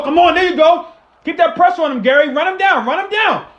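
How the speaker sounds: high, loud urging cheer; excited cheering. Real, not acted. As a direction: yell them on to the quarterback. Cheering pass rush live.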